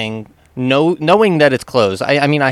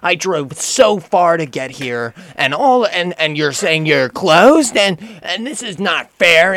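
mock ranting customer; 6 semitones higher, 3 dB louder, slower than usual. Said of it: "she's just ranting and ranting".

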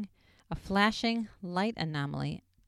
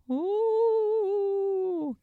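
long high wavering ghostly ooh; cartoon ghost ooh. Shown as a demonstration. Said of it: "a ghosty sound would be, like".

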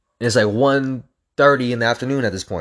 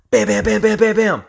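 high, punchy bam bam bam; rope slam noises; 11 semitones higher, 4 dB louder than usual. Retold or imitated. Runner mimicking battle ropes with high bams. Imitating rope slams again.

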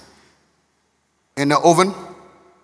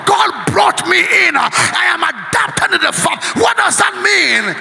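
full-force preaching; 7 semitones higher than usual. Real, not acted. Preacher proclaiming adoption by God, high exultant shout. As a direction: shout the good news.

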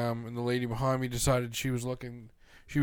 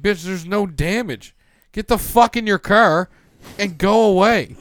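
louder, higher, furious yelling at the crying driver; angry yell; 7 semitones higher, 12 dB louder than usual. Retold or imitated. Driver yelling at the woman who rear-ended him, furious shout. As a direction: blast her to get back in her car. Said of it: "And I'm like".

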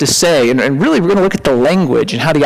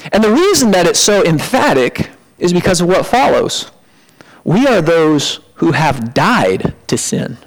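ordinary talk

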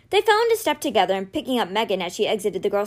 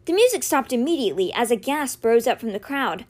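ordinary delivery, nothing marked

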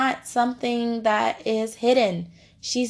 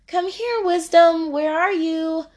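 searching call; 7 semitones higher than usual. Pretend. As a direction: call out high as if looking for someone. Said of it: "you don't even have to be like".

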